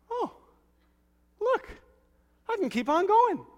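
mock triumphant believer. Pastor acting a believer getting up, high and proud. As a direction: show off like a proud kid.

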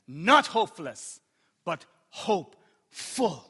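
forceful preaching with weighty pauses; emphatic preaching. Real, not acted. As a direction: drive the point home to the congregation. Preaches key point emphatically.

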